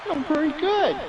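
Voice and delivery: high, sing-song teacher praise; a hint of a sweet schoolteacher; teacher praising. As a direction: praise the pupil like a primary teacher.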